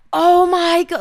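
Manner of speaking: high, loud imitated shout; mock excited yell from a guy in the group; retold shout. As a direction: belt it out like the guy who just saw her follower count. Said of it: "just shouting in the middle of the group".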